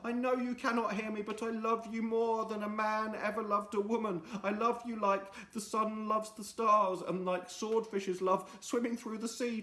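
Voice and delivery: high, swooning lament; melodramatic farewell. Acted. Friday bidding Missus Lovely farewell, melodramatic. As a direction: ham up a tragic goodbye.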